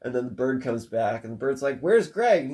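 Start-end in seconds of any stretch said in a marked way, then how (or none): 1.8-2.4 loud, high Where's Greg?; urgent demand. Retold or imitated. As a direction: demand it, alarmed. That's what happened first.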